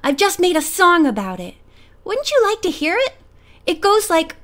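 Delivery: keen to show off a new song; eagerly offering, lifted pitch. A narrator's reading. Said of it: "cried little Georgie eagerly".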